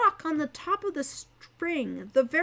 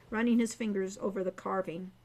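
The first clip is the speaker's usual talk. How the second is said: ordinary delivery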